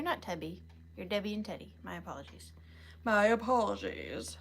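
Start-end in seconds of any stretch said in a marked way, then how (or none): 3.0-4.4 mock-formal apology. Streamer apologizing for merging names, playfully overdone. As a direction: stretch it out in a put-on voice.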